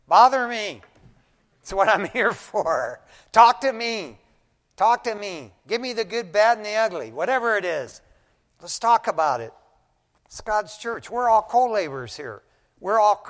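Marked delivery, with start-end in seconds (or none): 0.1-9.5 loud, raised-pitch urging; open-armed plea at full voice; emphatic invitation. Real, not acted. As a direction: preach it out, punchy and insistent. Urgently invites people to approach him.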